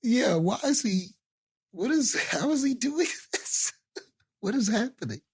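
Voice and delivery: high-pitched, laughing bewilderment; mock bafflement. Co-host joining the riff on the movie scene, baffled. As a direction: stumble through the questions, voice climbing high.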